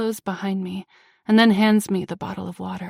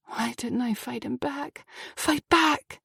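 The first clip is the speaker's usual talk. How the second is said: usual speaking voice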